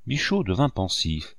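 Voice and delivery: ordinary delivery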